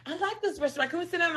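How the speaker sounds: high, soft imitated request; Jazz. Companion wants to eat at the restaurant, imitated high soft request. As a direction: ask lightly in a small high voice. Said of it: "Jazz always like to sit down".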